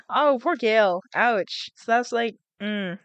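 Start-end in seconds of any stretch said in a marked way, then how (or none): none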